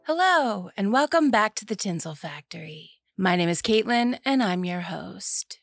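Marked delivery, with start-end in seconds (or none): none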